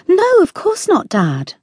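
sharp high protest, voice leaping up; cried out. A narrator's reading. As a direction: exclaim it, stung by the accusation. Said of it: "Sammy cried".

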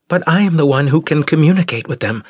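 high, quick protest; insistent Osira. Acted. Daughter interrupting father to volunteer, quick and insistent. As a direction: cut in fast and high.

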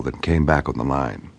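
plain reading voice

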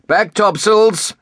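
high, drawn-out captain's command; called order. A narrator's reading. Captain to his crew aboard the frigate, calling out. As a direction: call it out slowly, pitched far up. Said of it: "the captain called".

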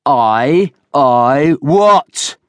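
loud mocking roar, rising high. A narrator's reading. Villiers mocks Oland's stammer, roaring. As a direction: mimic the stammer, then bellow the question. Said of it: "roared Villiers".

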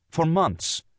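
high, short protest; insist, pitched right up; crying out. A narrator's reading. Wife afraid husband will laugh it off, crying out. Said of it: "afraid suddenly that he would laugh".

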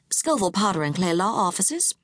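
lightly voiced phone receptionist; a hint of a professional receptionist. A narrator's reading.

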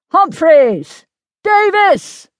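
high, loud summons; urgent shout. A narrator's reading. Major summons his men to the cannon, shouting urgently. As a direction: yell the names across the field.

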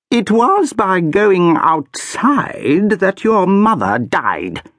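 high, slow, cruel reminder; coldly wound the child; haughty archduchess. A narrator's reading. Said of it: "the Archduchess reminded her".

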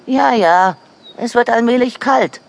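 high-pitched animal character voice; high put-on badger voice. A narrator's reading. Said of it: "antwortete der Dachs".

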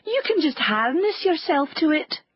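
a hint of an eager little hen; hen's voice. A narrator's reading. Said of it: "said to the cock".

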